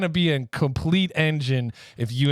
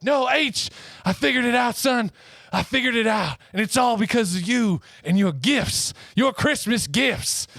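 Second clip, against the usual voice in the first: excited dad; 9 semitones higher than usual. Retold or imitated. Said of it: "And he's like".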